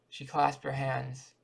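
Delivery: plain reading voice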